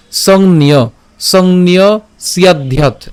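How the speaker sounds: "সংন্যস্যাধ্যাৎ" very slowly, in separated chunks; pronunciation demo. Shown as a demonstration. Teacher models "সংন্যস্যাধ্যাৎ" for learners, very slow chunks. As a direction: break "সংন্যস্যাধ্যাৎ" into slow syllable groups. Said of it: "উচ্চারণ কেমন হচ্ছে".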